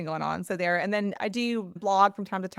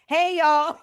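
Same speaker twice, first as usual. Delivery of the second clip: higher, louder Southern greeting; 7 semitones higher, 5 dB louder than usual. Retold or imitated. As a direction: lay on the Southern twang. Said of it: "me from the South".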